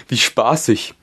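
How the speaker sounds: high, loud amused gryphon; chuckling gryphon. A narrator's reading. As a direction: chuckle it gleefully. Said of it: "gluckste er". (glucksender Greif)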